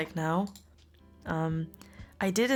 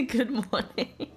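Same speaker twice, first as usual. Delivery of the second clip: laughing; 6 semitones higher, slower than usual. Real, not acted. Giggly greeting.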